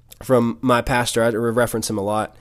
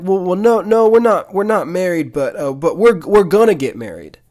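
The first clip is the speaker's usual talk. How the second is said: high, loud, stammering protest; flustered excuse, acted; 7 semitones higher, 6 dB louder than usual. Couple explaining themselves to their teacher, flustered. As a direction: imitate a couple backpedalling, pitched up and louder.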